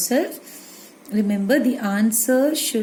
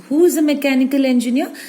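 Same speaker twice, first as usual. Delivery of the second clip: emphatic question; 5 semitones higher, 6 dB louder, faster than usual. Shown as a demonstration. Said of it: "models the alternative question".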